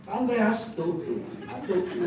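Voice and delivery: loud, high retold call; excited calling. Man calls friend to see the healed patient, excited. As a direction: call someone over to look, acted excitement.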